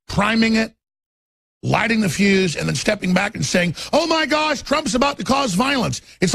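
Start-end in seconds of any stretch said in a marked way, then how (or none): 3.9-6.0 higher mock gasp of panic; sarcastic pearl-clutching voice; mock alarm. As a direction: ham up the fake shock. Mocking media alarm.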